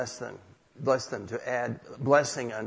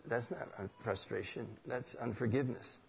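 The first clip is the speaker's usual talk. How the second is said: quiet correction; 2 semitones lower, 9 dB quieter than usual. Retold or imitated. God answers the frustrated man's prayer, quiet correction. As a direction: correct gently, barely raising the voice.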